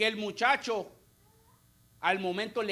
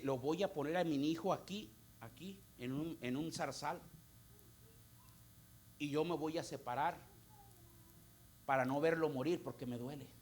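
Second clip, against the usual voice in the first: grieving mother's words; 7 semitones lower, 9 dB quieter than usual. Retold or imitated.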